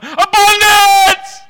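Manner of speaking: high, loud, slow drawn-out scream; screamed Abundance. Shown as a demonstration. Preacher showing the church how to cry out, joyful scream. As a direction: scream it in joy. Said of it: "imagine screaming out".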